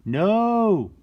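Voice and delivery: high loud shout of no; sharp no. Acted. Reading to kids, warning not to bug the bear, shouted. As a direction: cry out a warning.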